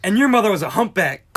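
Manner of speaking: high, loud retort; angry insult. Acted. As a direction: hurl the insult back hotly. Hurls insult back in quarrel.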